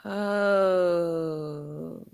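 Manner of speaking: ordinary delivery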